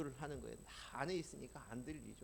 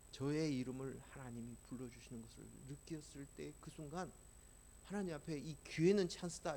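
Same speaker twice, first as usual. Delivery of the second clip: ordinary talk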